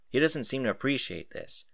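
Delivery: ordinary delivery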